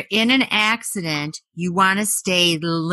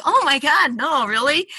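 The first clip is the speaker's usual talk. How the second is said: mock surprise; 9 semitones higher than usual. Feigned shock.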